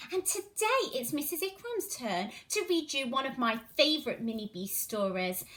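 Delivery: plain reading voice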